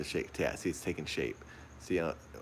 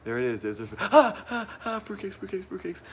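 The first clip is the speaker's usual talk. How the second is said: very high, shrill yelps of fright; high panicked squeals, loud then dropping; shrieking panic; 14 semitones higher, 7 dB louder than usual. Real, not acted. Finding piece while terrified.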